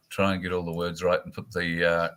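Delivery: normal talking voice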